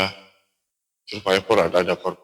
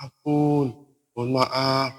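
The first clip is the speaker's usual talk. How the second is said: higher-pitched, mildly acted plea; begging forgiveness; 6 semitones higher, much slower than usual. Frightened climber bowing to beg forgiveness, imitated pleading. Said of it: "dia sujud dan minta ampun".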